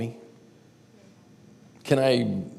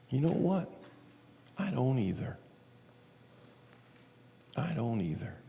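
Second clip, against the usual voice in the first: soft, higher-pitched dawning relief; quiet realization; 4 semitones higher, 7 dB quieter than usual. Retold or imitated. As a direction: say it hushed, as if it just sank in.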